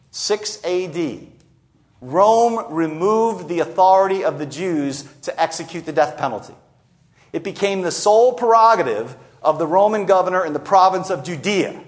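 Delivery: ordinary talk, nothing marked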